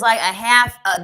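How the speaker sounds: usual speaking voice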